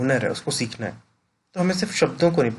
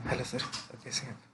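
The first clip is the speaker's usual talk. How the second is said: much softer, subdued hello; soft greeting; 13 dB quieter, faster than usual. Shown as a demonstration. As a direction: say it quietly, almost withdrawn. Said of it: "different type की tone होगी".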